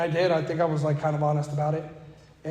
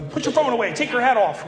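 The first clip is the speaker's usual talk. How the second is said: higher, louder orders; barking orders at the guys, mildly; mock yelling; 7 semitones higher, 5 dB louder than usual. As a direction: sponsor yelling at his sponsees, mock orders. Yelling at sponsees.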